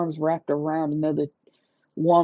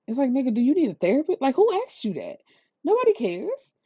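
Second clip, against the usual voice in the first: fast, much higher dismissive jab; exasperated mockery; 7 semitones higher, faster than usual. Real, not acted. Vlogger mocking the man's speech, fast and high. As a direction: rush it out high, dismissing him.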